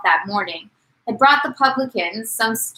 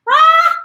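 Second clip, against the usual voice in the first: high, loud exclamation of realisation; a sudden high "Ah!" as it clicks; 9 dB louder than usual. Real, not acted. Exclaims on learning word's meaning.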